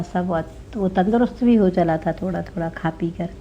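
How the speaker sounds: ordinary delivery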